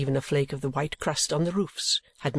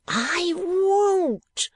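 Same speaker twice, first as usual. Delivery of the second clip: very high, furious wife's scream; high-pitched acted scream; screamed refusal; 4 dB louder than usual. A narrator's reading. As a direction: shriek it in outraged defiance. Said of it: "screamed the woman".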